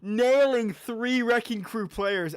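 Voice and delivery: hyped slow call. Real, not acted. Hyped casting of the play.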